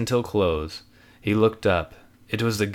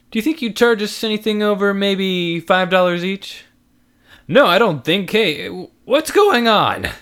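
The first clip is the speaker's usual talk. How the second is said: wheedling then confused; 10 semitones higher, 5 dB louder than usual. Acted. Haggle, clerk confused.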